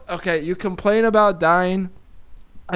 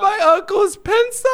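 can barely get it out for laughing; laughing through words; 12 semitones higher than usual. Real, not acted. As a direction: voice cracking high with laughter. Breaks up laughing mid-rap.

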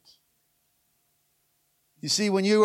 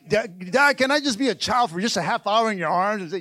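usual speaking voice

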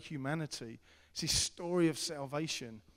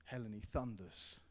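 softer, lower aside; quiet emphatic aside; hushed contrast; 5 semitones lower, 8 dB quieter than usual. Real, not acted. Hushed aside after thunder line.

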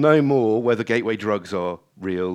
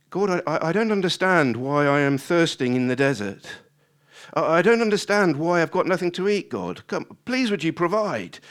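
higher-pitched pleading complaint to God; grumbling prayer; 6 semitones higher, 4 dB louder than usual. Acted. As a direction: protest to God, baffled and pleading. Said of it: "it probably goes something like this".

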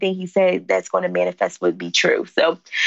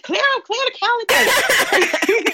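high, slow, laughing exclamation; excited, laughing; 13 semitones higher, much slower than usual. Retold or imitated. Guest recalling the podcast invite email, laughing excitedly. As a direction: gush it through a laugh.

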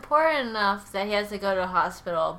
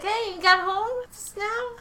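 very high, loud mock-cheery greeting; all cheery; 5 dB louder than usual. Husband greeting his wife home, all cheery. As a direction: put on exaggerated cheer, singsong high. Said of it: "he's, like, all cheery".

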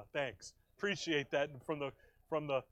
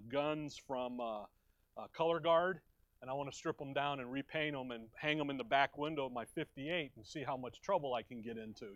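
usual speaking voice

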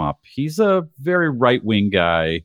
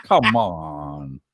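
long, falling 'oh, come on'; drawn-out protest; 4 semitones lower, much slower than usual. Real, not acted. Objecting to a jab about an AARP card, drawn-out protest. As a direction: groan it out in protest at the teasing. Teased about old people's streaming.

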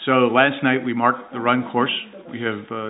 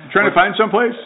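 nosy neighbor; 9 semitones higher, 5 dB louder, faster than usual. Retold or imitated. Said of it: "retelling the neighbor's questions".

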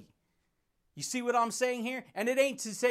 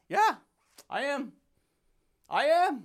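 emphatic loud reply; 6 semitones higher, 6 dB louder than usual. Real, not acted. Answering critics who call him fired up, a loud high declaration. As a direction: punch it higher and louder. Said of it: "emphatic reply to critics".

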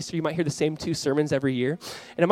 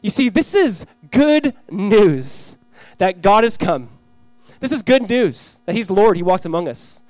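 raised, deliberate proclamation; emphatic preaching; 4 semitones higher, 6 dB louder than usual. Real, not acted. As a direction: proclaim it to the room, stretching key words. Proclaims good news at force.

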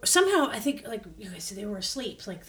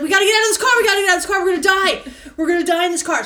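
high, loud panicked shout; mock-frantic cries to get out; retold panic; 7 semitones higher, 10 dB louder, much faster than usual. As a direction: shout it like you're trapped. Panicking to escape car.

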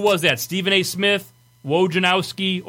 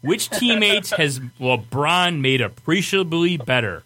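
drawn-out, very slow quote reading; slow, deliberate reading; slower than usual. Acted. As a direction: read it out slowly, stretching the words. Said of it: "I'll say it again slowly".

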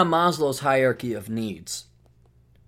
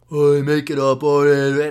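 doubting listeners; 4 dB louder than usual. Acted. Host imitating doubting listeners who think it's made up, mocking. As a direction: mimic the sceptics in a put-on voice. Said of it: "a lot of you are kinda".

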